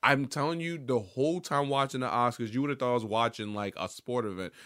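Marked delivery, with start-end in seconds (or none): none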